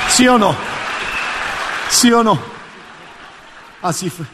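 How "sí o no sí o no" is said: an excited fan insisting; excited high question. Retold or imitated. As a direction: press him excitedly for an answer. Excited lady asking him.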